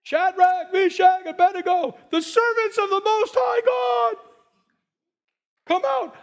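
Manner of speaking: high, loud calling into the furnace; king's shout. A narrator's reading. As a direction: bellow the three names over the fire. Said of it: "he shouts at the top of his lungs".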